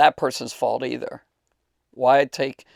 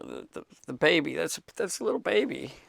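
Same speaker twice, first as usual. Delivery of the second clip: getting emotional; 4 semitones higher, 5 dB quieter than usual. Real, not acted. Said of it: "I'm gonna get emotional".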